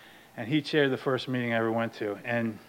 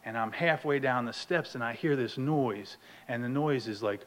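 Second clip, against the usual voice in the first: ordinary talk, nothing marked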